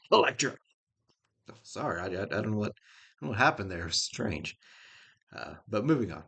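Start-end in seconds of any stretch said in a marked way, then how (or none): 0.1-0.5 laughing jab. Real, not acted. Laughing jab at Matt's exes.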